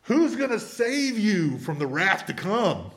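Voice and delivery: slow, high, thundering rebuke; John the Baptist rebuking. Retold or imitated. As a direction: draw out each word like a prophet condemning. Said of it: "And he said".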